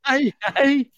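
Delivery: high, hammed-up Australian accent; joking Australian voice, pitched well up; mock Australian accent. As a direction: ham up a bogus Aussie greeting. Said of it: "me talking like this".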